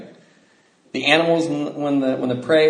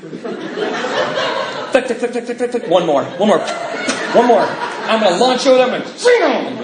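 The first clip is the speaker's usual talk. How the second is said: giddy mock glee; 9 semitones higher, 3 dB louder, slower than usual. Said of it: "And I'm just like going".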